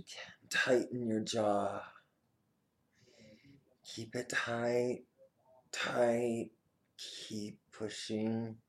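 firm, urgent coaching cues; energetic exercise coaching. Acted. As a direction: push them to tense and hold. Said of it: "tense body exercise cues".

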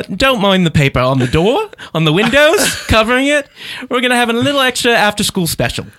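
high-pitched put-on British teacher; British accent. Host as shady teacher addressing his class, British accent. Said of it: "do our best British accent".

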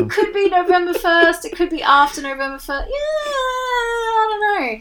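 high, wavering mock whine, slowing to a stretched end; mock whiny Trump. Podcaster mocking Trump's vague answer, whiny and high. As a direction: whine it out like a dodging politician.

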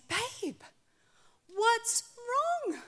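clueless husband asking sweetly; innocent husband. Retold or imitated.

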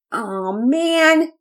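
high, drawn-out groan of protest; whiny, disappointed boy; complaining.

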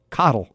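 Cottle as KOT-ul, falling; corrected name pronunciation. Shown as a demonstration. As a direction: practice Cottle with stress on the first syllable. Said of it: "That's pronounced".

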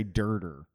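"dirter" said as Delaware County locals say daughter, with an r sound in the middle; Delco "daughter". Retold or imitated. Mimicking how Pennsylvania people say "dirter" for daughter. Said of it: "how they said "daughter".

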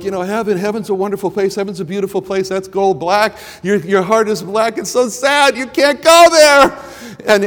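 put-on high, sing-song children's storytelling; story for children. Shown as a demonstration. Preacher showing how he tells kids the wordless book, sing-song. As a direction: tell it like to little kids, wide-eyed.

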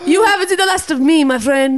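high hammed-up villain vow; foiled villain threat. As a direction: shake a fist, cartoon villain. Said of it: "Delgado at the border going".

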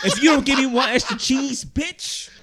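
mock husband shouting for extra cheese; mock yell. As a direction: yell it like a joking tyrant. Mock threat to wife.

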